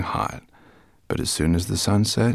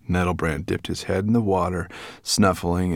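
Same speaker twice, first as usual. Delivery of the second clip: ordinary delivery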